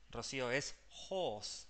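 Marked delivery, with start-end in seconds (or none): 1.1-1.7 "horse" with long O and no R; silent R. Shown as a demonstration. Teacher corrects Rocío, "horse" without the R. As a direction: drop the R in "horse", holding the vowel. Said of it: "sin la R".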